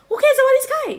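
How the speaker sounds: very high, loud outburst; loud, high-pitched brush-off; emphatic dismissal. Real, not acted. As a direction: cry it out in exasperated encouragement. Urging viewer to forget the man.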